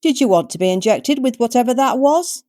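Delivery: put-on old mother countering her son; tart old biddy. A narrator's reading. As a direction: snap back like a cheeky granny. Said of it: "the old biddy countered".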